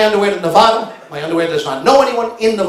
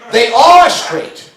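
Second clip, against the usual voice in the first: mock clerk insisting; 6 semitones higher, slower than usual. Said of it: "she says".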